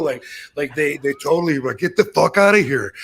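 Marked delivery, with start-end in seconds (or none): 1.8-2.9 retold yell. Wrestlers urging him out.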